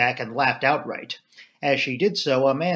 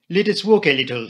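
put-on higher, louder French-accented invitation; Poirot; 4 semitones higher, 6 dB louder than usual. A narrator's reading. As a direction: invite a friend as a Belgian detective. Narrator voices Poirot.

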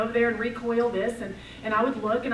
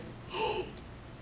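mock gasp; 9 semitones higher, 10 dB quieter than usual. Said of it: "And he goes".

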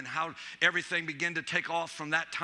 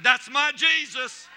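triumphant; 4 semitones higher, 5 dB louder, slower than usual. Retold or imitated. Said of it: "I said".

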